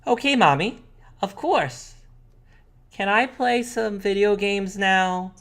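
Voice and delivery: put-on high-pitched kid asking mommy; little sister. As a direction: ask nicely in a high kid voice. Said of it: "She says".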